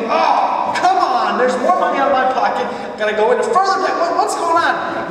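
high, loud, fed-up griping; mock fed-up moaning over the money; exasperated complaining. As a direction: whine about the broken bus, loud and high. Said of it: "It's very easy to go".

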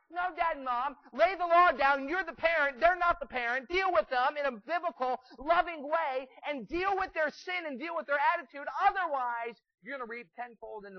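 high, very loud exhortation; full-force preaching. Real, not acted. Preacher orders parents to lay the law down, full-force preaching. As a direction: thunder it out to the congregation.

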